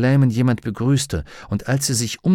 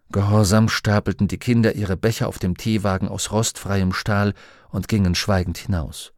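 usual speaking voice

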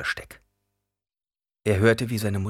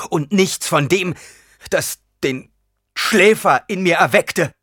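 slow, loud, high accusation; halting, heated reproach; bitter outburst; 10 semitones higher, 9 dB louder, slower than usual. A narrator's reading. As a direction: force the words out at her. Bitter son accuses mother.

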